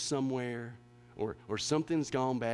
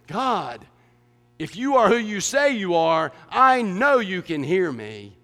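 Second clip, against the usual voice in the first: louder, demanding plea to God; insistent prayer; 4 semitones higher, 9 dB louder than usual. Acted. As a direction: insist God must be listening. The prayer echoing mom's words.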